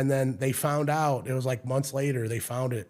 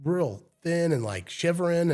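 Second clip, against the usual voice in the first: ordinary talk, nothing marked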